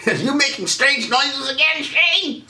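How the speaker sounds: high-pitched nagging yell; mock yell. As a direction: imitate someone yelling in a high voice. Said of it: "people would yell at me".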